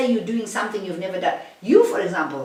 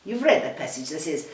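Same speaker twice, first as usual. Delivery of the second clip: ordinary talk, nothing marked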